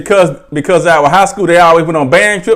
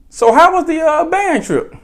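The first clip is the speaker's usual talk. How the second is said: high, softer innocent question; feigned innocence; 8 semitones higher, 5 dB quieter than usual. Retold or imitated. Grandmother questioning cousin she knows lied, falsely innocent. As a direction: ask sweetly as if you know nothing.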